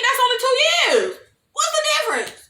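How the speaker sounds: very high-pitched mock protest; squeaky-high, hammed-up disbelief; mock incredulous. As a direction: argue it as absurd, voice shooting up. Mock-arguing the absurd age gap.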